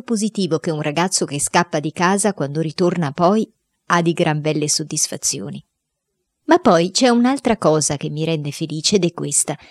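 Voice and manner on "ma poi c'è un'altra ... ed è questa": ordinary delivery, nothing marked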